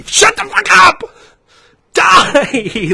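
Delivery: loud, high-pitched furious scream; upset screaming. Real, not acted. Ranter shouting down coffee brand loyalists, upset screaming. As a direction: scream them down at full pitch. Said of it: "This shit makes me so upset".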